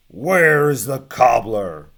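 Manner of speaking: loud, slow roaring giant. Acted. Giant at the cobbler's shop, roaring for him. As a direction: roar it out to shake the shop. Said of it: "the giant roared".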